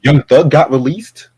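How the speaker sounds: loud, slow, dramatic reveal; excited announcement. Real, not acted. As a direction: announce it like big news.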